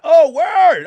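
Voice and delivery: high, loud mock-excited reply; mock hyped. Streamer to internet tech, loud mock-excited reply. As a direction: act thrilled at the news.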